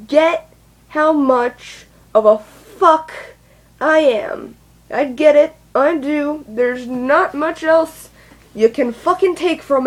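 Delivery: slow, forceful, word by word; emphatic insistence. Real, not acted. Emphatic plea to be believed.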